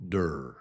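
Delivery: "der" with a quick d in place of t; low, unstressed "der" starting on a d; soft d sound. Shown as a demonstration. Teacher shows the second syllable, "der" said with a d. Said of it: "It's really kind of a d".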